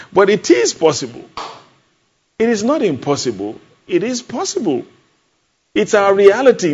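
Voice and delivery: fervent preacher insisting; excited insistence. Acted. Preacher exclaiming possibility.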